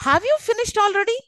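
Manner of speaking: high "Have you finished already", rising at the end; high rising yes-no question ending on "already". Shown as a demonstration. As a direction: pitch it high and lift "already". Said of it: "which is rising up".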